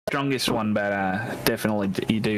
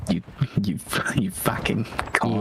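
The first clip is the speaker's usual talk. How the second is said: laughing whisper; 2 semitones lower than usual. Pretend. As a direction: breathe it out as a secret, giggling. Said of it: "whispered joke".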